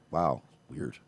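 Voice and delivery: soft, low, deadpan wow weird; passive-aggressive. Retold or imitated. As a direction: mutter it with quiet disdain. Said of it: "his very passive-aggressive little".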